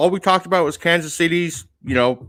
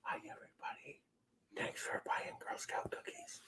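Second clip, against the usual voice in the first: whispered prompt then repeated; 27 dB quieter than usual. Shown as a demonstration. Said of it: "let me feed you the line".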